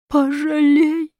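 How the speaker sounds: high, long drawn-out begging; slow, high wail of a plea; imploring child. A narrator's reading. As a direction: implore like a small boy. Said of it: "взмолился маленький Чукча".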